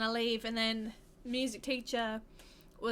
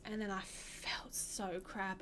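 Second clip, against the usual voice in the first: whispered; 3 semitones lower, 6 dB quieter, slower than usual. Real, not acted. Recalls feeling bad after song.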